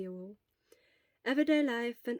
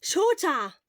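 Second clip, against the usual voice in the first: loud, high call to wake someone; shouting; 8 semitones higher, 11 dB louder than usual. Acted. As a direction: yell his name across the room. Friend protesting loudly.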